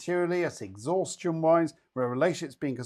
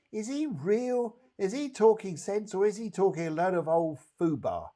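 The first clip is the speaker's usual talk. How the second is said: imitating doubtful client; 7 semitones higher than usual. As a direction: voice her inner doubt, incredulous. Voicing client's doubtful thoughts.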